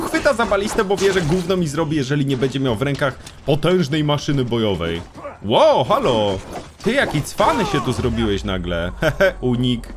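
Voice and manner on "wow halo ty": ordinary delivery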